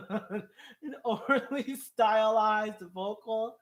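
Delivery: drawn-out words breaking out of a laugh. Real, not acted. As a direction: repeat it through real laughter, drawing it out. Laughing over a commenter's wording.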